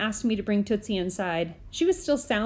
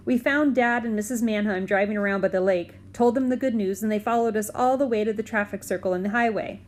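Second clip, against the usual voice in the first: plain reading voice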